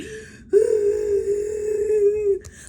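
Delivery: high crying gasp. Real, not acted. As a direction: gasp sharply, almost crying. Embarrassed in the ER.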